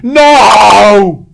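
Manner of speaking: loud, high drawn-out wail. Acted. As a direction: wail helplessly while spun. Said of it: "the sergeant wailed".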